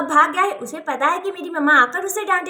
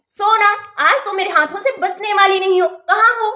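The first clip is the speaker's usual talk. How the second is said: raised, threatening mother's call; angry calling out; 4 dB louder than usual. Acted. Mother hunting for her cheeky daughter, angry calling out.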